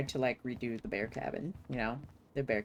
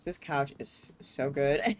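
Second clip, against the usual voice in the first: normal talking voice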